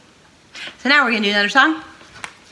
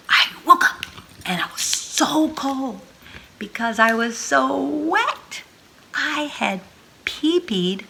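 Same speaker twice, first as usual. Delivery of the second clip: shivery dismay; 6 semitones higher, slower than usual. Acted. Waking cold and wet.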